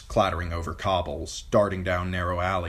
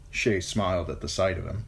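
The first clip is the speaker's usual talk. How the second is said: usual speaking voice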